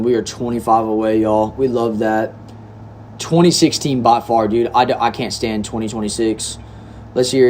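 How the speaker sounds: ordinary talk, nothing marked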